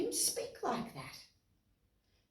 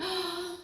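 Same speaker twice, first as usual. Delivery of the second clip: gasp; 7 semitones higher, 5 dB quieter than usual. Acted. Reader gasps at story.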